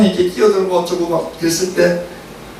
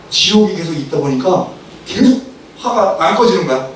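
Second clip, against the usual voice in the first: ordinary talk, nothing marked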